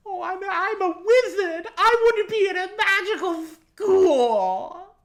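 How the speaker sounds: very high, loud, slow squeal; mocking fan voice. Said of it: "Harry Potter fans".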